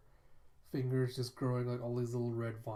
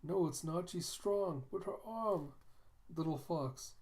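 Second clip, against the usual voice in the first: put-on high young voice; 7 semitones higher than usual. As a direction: speak up like a small child. Young companion speaking to miss.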